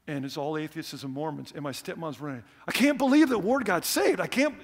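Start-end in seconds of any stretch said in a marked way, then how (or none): 2.7-4.6 high, loud, thrilled; excited stepmom. Retold or imitated. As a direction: gush like someone who can't believe the news. Stepmom running, voiced.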